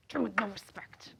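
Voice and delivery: a mock hushed aside; whispered echo. Imitating Sarah muttering to Michael, whispered echo. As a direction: repeat it under your breath as a whisper. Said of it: "I do a great Sarah impression".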